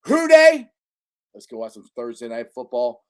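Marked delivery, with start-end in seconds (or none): 0.1-0.7 high, loud team call; rallying fan cry. Real, not acted. Host rallying Bengals fans at sign-off, shouted team cry. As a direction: holler it to fire up the fans.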